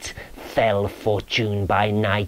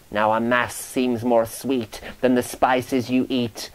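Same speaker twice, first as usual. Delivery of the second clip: plain reading voice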